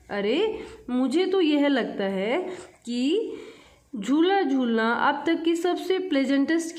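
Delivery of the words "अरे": ordinary talk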